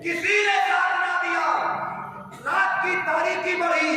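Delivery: high, fervent sermon voice; rousing preaching. Acted. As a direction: build the listeners' emotion. Climactic preaching, pitch raised. (पुरजोश तक़रीर)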